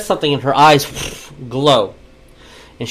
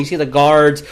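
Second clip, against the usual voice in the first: ordinary talk, nothing marked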